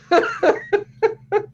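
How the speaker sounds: high breathy laughter; amused laughter after the quote. Real, not acted. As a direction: laugh it off brightly.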